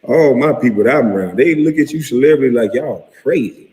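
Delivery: normal talking voice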